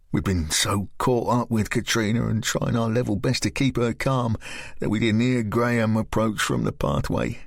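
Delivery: ordinary delivery, nothing marked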